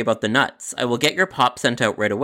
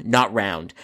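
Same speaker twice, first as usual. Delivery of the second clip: plain reading voice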